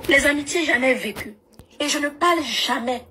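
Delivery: ordinary talk, nothing marked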